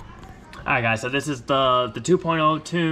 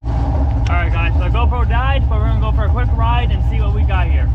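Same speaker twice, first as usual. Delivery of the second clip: loud; 7 semitones higher, 9 dB louder than usual. Real, not acted. Outdoor ride, shouting over noise.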